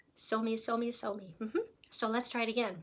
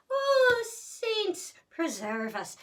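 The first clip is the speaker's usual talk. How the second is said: a high, loud Oh, then a slow Irish saints preserve us; hammed-up Irish astonishment; mock Irish exclamation; 11 semitones higher, 4 dB louder, much slower than usual. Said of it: "let's switch to Irish".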